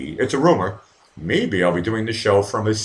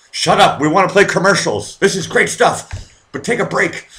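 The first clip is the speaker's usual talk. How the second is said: higher and louder mock shout, slow on this is great stuff; mock impatient TV stations; 11 semitones higher, 5 dB louder than usual. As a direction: yell at Trump like a station boss who wants ads. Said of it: "television stations were like".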